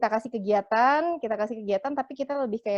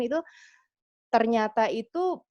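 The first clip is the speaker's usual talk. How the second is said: normal talking voice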